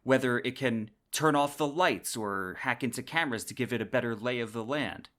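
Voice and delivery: usual speaking voice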